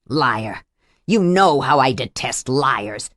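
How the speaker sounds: low, cold and menacing; menacing abuser. Acted.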